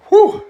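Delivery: long high-pitched whoop at the burn. Real, not acted. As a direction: let the heat out in a piercing whoo.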